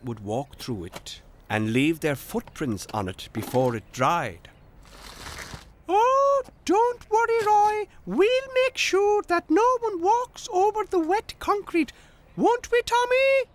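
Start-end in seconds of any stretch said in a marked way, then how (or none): 5.9-13.5 very high, loud cartoon voice; squeaky storybook helper; Tech. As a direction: reassure eagerly in a tiny piping voice. Narrator voices Tech promising.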